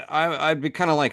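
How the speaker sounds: ordinary talk, nothing marked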